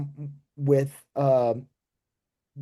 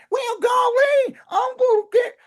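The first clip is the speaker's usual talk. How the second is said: hillbilly TV character; 5 dB louder than usual. Acted. Actor on a show in character, hillbilly voice. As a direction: holler it like an exaggerated hillbilly. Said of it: "that's the character".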